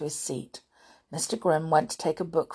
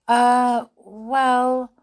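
high, loud drawn-out stalling; hesitant pupil; 8 semitones higher, 8 dB louder than usual. A narrator's reading. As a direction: stall for time before the teacher. Molly stuck for an answer.

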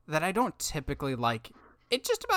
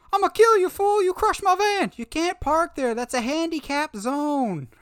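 high, loud shouting movie character; yelling movie quote; 12 semitones higher, 5 dB louder than usual. Retold or imitated. Podcast host quoting Murdoch's van rant, high shout. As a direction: bellow it like a furious Murdoch. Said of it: "One of my favorite lines in any movie is".

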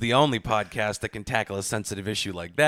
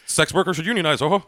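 jolly ho ho ho; 5 semitones higher, 4 dB louder than usual. Acted. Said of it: "Robin Williams version of a feminist".

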